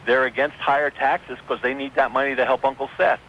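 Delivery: ordinary delivery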